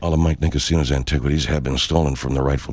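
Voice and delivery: very low, rapid small-print read; deep-voiced fast disclaimer. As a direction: rush through the legal tag in a deep drone. Rapid disclaimer ending the parody ad.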